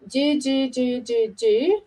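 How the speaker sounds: "do" repeated evenly as tongue-tip strokes; tonguing syllable demo. Shown as a demonstration. Oboist previewing a short exercise, even "do" syllables. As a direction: tap out five even "do" syllables. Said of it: "I'm gonna go".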